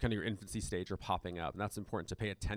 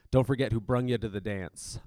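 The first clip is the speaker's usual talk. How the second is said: louder Southern twang quote; 8 dB louder than usual. Retold or imitated. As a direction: imitate a folksy Southern preacher. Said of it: "it's like a super Southern twang".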